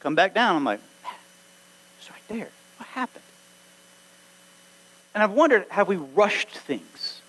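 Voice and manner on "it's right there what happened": near-silent, puzzled murmur; baffled hush. Retold or imitated. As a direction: ask it under your breath, bewildered. Bewildered inner aside.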